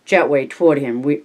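plain reading voice

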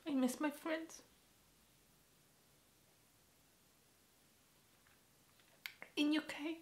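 high, soft, tearful voice; small voice choked up with missing someone; crying. Real, not acted. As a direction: squeeze it out through real tears. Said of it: "I'm just crying and laughing".

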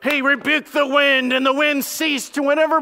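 a hint of a showy preacher winding up; preachy pastor. Preacher mocking how pastors rush past this part, hammed-up pulpit voice. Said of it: "this is where pastors would go like".

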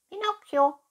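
very high, loud cat echo; cat's good night. A narrator's reading. Cat echoes the fox's good night, high-pitched. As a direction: squeak the name after the fox. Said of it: "wünschte die Katze".

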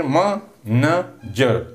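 "manager" slowly, in three separate beats; split into syllables. Shown as a demonstration. Teacher counting three syllables for students, "manager" slowly broken up. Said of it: "There are three syllables".

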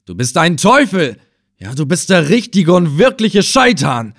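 put-on the old man curses the Effendi as a devil, furious; grimmest tone. A narrator's reading. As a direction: hurl the curse at him. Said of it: "in seinem grimmigsten Tone".

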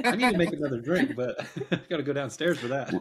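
laughing. Real, not acted. Joking about another drink.

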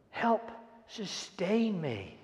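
ordinary talk

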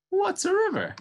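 high squeaky curious mouse question. Acted. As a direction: ask with innocent wonder. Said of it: "Mouse asked curiously".

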